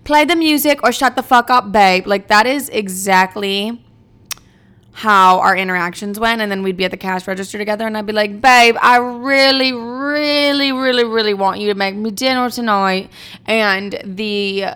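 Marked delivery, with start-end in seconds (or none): 0.1-2.1 loud, higher mock British accent. Telling sister to get in the car, loud mock British accent. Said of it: "I cannot stop doing this British accent". 8.4-13.1 put-on British accent. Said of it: "Stop talking to me in that accent".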